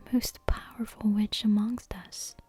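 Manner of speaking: ordinary delivery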